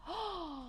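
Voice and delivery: sharp mock gasp of delight; excited gasp. Client gasps at the idea of a referral.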